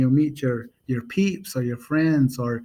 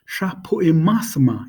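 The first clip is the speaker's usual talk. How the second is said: "Sha Pui Mas Ma" run together as one phrase; fast, natural version; 3 semitones higher than usual. Shown as a demonstration. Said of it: "You'd say it fast".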